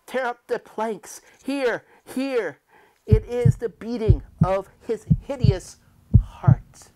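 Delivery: high, loud, breaking-down yell; frantic confession. Acted. Murderer screaming his guilt at the officers, frantic. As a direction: confess at the top of your voice. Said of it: "I shrieked".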